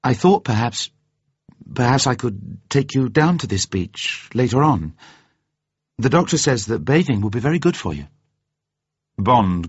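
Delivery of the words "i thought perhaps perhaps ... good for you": higher voice, hesitant start then carrying on; tentative but determined suggestion, pitched up; brave persistence. A narrator's reading. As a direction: keep offering bravely in a lifted woman's voice. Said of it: "She continued bravely".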